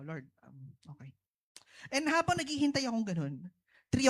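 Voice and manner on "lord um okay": soft, near-silent word to the Lord; hushed prayer. Retold or imitated. Husband waiting outside surgery, praying softly to God. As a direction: let it go under your breath, surrendering.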